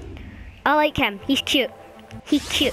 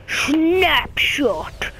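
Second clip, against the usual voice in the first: slow, drawn-out raspy whisper; game character impression. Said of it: "He kinda talks like this".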